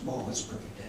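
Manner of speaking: very soft, low murmur; hushed quote. Retold or imitated. Quoting a delighted remark, hushed.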